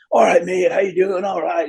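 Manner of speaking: a breezy Northern Irish hello, mate; Northern Irish accent. Retold or imitated. Bassist imitating Ricky greeting him on the bus, Northern Irish accent. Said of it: "proper Irish, Northern Ireland".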